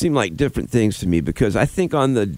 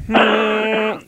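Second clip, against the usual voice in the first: demonstration hum; 12 semitones higher than usual. Shown as a demonstration. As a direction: hum a high note for someone to match. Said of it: "Try to match with me".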